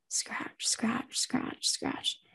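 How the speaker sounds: soft whispered scratching. Acted. Unseen presence scratching at Casey, whispered. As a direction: breathe the scratches out quietly. Eerie scratching sound in dark room.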